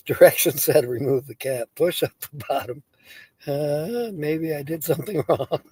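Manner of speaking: ordinary delivery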